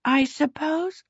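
slow, high, hesitant through food; words round a bite of pancake; mouth full. A narrator's reading. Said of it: "through a mouthful of pancake".

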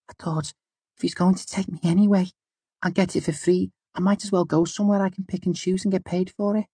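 put-on high, fast defiant girl; young girl voice. A narrator's reading. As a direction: blurt it out like a stubborn teen. Girl finally speaks up.

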